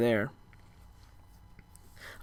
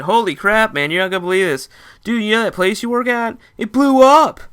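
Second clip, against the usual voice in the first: higher and louder, ending in a yell on blew up; excited shout; 9 semitones higher, 8 dB louder than usual. Retold or imitated. Friend bursts in with news that the workplace exploded, shouting.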